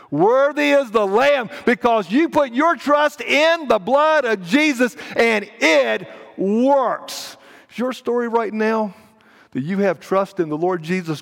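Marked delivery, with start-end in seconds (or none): 0.1-7.4 high, loud, drawn-out proclamation; exultant preaching. Real, not acted. Preacher praising the Lamb to congregation, exultant. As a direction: proclaim it at full force, punching key words. Exultant repeat at full force.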